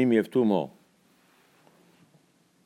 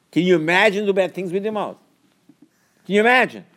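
high, loud rebuke to the congregation; preacher scolding his flock; fiery preaching; 7 semitones higher, 3 dB louder than usual. Acted. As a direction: hammer the point home from the pulpit.

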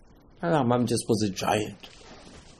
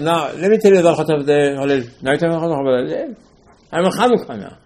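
raised, louder, baffled protest; mock indignant questioning; 4 semitones higher, 5 dB louder than usual. Student challenging the Rambam over where Hallel is, mock indignant. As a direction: argue it out, higher and insistent. Acted complaint addressed to the Rambam.

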